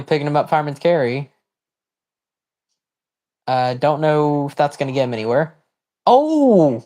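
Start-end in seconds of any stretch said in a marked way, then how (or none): 6.1-6.8 high, loud burst of surprise; jolted by the sudden move; startled outcry. Real, not acted. Streamer reacting to a Dev driver, startled outcry.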